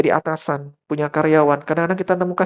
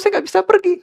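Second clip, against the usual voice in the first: ordinary delivery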